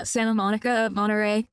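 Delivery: "Santa" as SAN-uh and "Monterey" as MON-uh-ray; dropped T. Real, not acted. Californian naming cities to chat, "Santa" and "Monterey" without the T. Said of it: "I'm not pronouncing the T".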